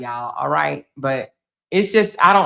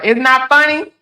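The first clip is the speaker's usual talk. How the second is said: higher, louder, rising disbelief; incredulous echo; 7 semitones higher, 5 dB louder than usual. Real, not acted. Echoing a viewer's comment back, incredulous rising. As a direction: throw the words back in disbelief.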